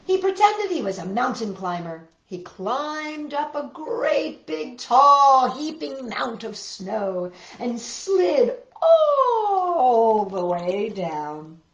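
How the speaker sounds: put-on gentle read-aloud for small children; picture-book narration for little listeners; storybook reading. A narrator's reading.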